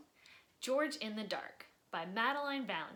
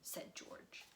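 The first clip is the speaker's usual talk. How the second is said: expressive read-aloud storytelling; lively children's book narrator; story narration; slower than usual. A narrator's reading. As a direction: read it like bedtime story time for kids. Reading a picture book aloud.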